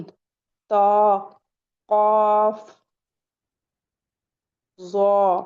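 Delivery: "तो, कौफ, जौ" rounded and held; heavy letter demo. Shown as a demonstration. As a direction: say "कौफ" long with a thick "aw". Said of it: "पूरी टंग उठाएंगे और ऊपर को लगाएंगे".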